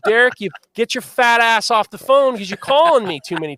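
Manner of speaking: loud yell into the phone; yelling high and loud at a pest; retold scream. Yelling at Derek over the phone, loud and high. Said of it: "I start screaming in the phone".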